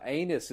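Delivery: anus said in place of "onus", AY-nus; word said wrong. Retold or imitated. Dim crook telling a guy anus for onus, said wrong. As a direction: botch onus into anus, long AY first. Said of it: "he gets words wrong".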